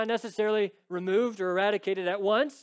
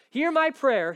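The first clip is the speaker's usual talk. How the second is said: louder, higher cry of pleading; intense plea; 8 semitones higher, 8 dB louder, slower than usual. Acted. Psalmist pleading with God, rising intensity. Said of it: "this growing intensity about his pleading".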